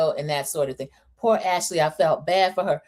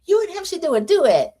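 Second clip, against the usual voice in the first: higher, eager suggestion; 10 semitones higher, 3 dB louder than usual. Retold or imitated. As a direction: pitch the duet idea brightly. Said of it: "I heard her say to Rosie".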